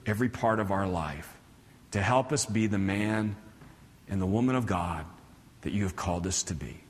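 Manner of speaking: soft, low pastoral prayer. Real, not acted. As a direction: pray softly, with pauses between phrases. Leads the congregation in prayer.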